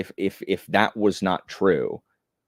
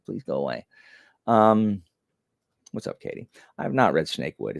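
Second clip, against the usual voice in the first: normal talking voice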